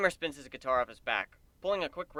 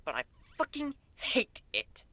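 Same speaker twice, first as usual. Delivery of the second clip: annoyed gripe; 10 semitones higher than usual. Acted. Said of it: "griping about the keyboard".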